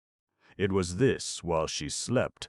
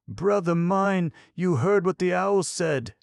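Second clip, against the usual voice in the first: high, loud tearful plea; through sobs; 14 semitones higher, 7 dB louder than usual. A narrator's reading. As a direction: wail it as the sobbing girl, pitched far up. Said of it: "but through sobs".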